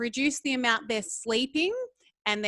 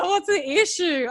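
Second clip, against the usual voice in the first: imitating partner; 8 semitones higher, 6 dB louder than usual. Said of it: "And he's like".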